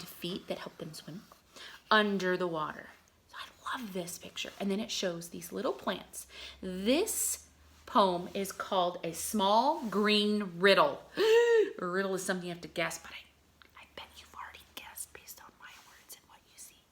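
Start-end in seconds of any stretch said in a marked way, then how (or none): 3.3-4.5 hushed whisper, breathy and close; soft whispered aside. Real, not acted. Aside to children about picture. 11.1-11.7 surprised gasp. Acted. Mock surprise at riddle title. 13.0-16.8 fast conspiratorial whisper; quick hushed aside to the kids. Acted. Teasing aside about the guess.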